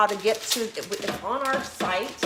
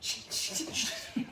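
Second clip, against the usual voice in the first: soft breathy laugh; 3 semitones higher, 8 dB quieter than usual. Real, not acted. Laughing at own stumble.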